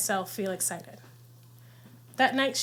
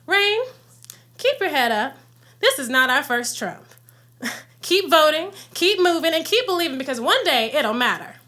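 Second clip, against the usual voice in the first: louder, rousing grandmother quote, a little higher; grandmother's encouragement; 4 semitones higher, 5 dB louder than usual. Retold or imitated. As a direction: lift them up like a proud grandmother. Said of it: "She said".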